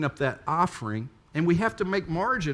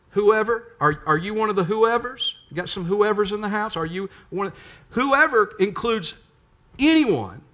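higher, louder preacher calling to the congregation; full-force preaching; 6 semitones higher, 5 dB louder than usual. Acted. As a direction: lift it and press them with the question.

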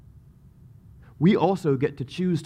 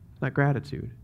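ordinary talk, nothing marked

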